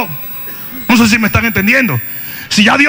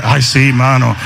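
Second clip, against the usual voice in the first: put-on Nicaraguan accent; 9 semitones lower than usual. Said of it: "era nicaragüense el tipo".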